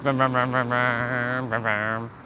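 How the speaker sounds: playful mock motorbike revving; engine vroom. Admiring the red Ninja bike, making playful engine vrooms. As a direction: rev it like a bike engine in your mouth.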